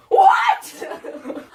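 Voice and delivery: high, loud shocked shout. Acted. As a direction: yell it in disbelief. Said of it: "All caps lock for extra emphasis".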